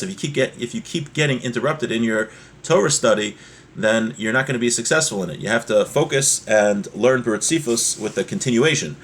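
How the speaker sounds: usual speaking voice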